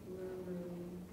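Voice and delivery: soft, stretched murmuring; lengthened murmur. Shown as a demonstration. Teacher savouring the word, soft and stretched. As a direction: let the word hum out quietly. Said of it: "Beautiful for its murmuring".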